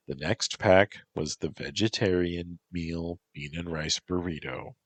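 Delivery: plain reading voice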